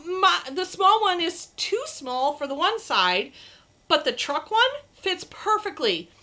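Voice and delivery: normal talking voice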